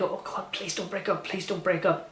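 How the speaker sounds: soft hushed plea; begging under the breath; pleading whisper. Retold or imitated. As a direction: whisper a desperate little prayer to the screen. Begging the couple on screen.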